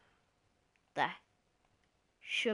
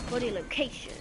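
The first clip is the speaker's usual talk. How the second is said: normal talking voice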